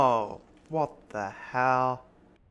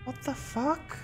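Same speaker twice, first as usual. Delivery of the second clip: startled; 12 semitones higher, 4 dB quieter, slower than usual. Real, not acted. Startled by ghostly announcement.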